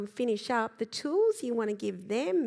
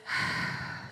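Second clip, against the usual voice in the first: audible slow out-breath; demonstrated sigh. Shown as a demonstration. Coach resetting the nervous system, slow sigh. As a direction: let a long breath go after a pause. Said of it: "now when we sigh".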